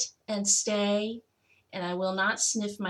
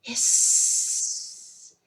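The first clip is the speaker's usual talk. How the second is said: monster hiss. A narrator's reading. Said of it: "a horrible hissing sound".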